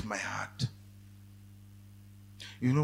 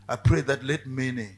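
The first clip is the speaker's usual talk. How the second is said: closing prayer; 3 semitones lower, 7 dB louder than usual. Acted. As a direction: pray for the congregation, building. Preacher leads a prayer.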